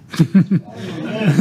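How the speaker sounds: a quick real laugh at his own punchline; short real laugh. Real, not acted. Preacher laughing as he tells the woman it is him.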